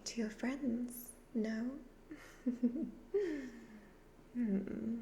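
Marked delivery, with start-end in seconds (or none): 2.1-3.8 higher, teasing wicked laugh; evil laugh. Acted. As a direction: laugh slyly at your own trick. Devil laughs after sly request.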